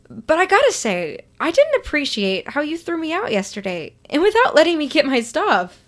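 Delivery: playful scold; mild scolding. Acted. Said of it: "You scold him".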